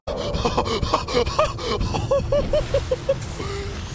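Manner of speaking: high-pitched relieved laughter; nervous high laugh. Real, not acted. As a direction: laugh off the fright. Nervous laugh after escape.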